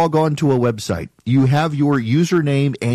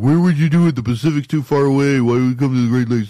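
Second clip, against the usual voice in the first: mock doubter; 4 dB louder than usual. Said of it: "People are like".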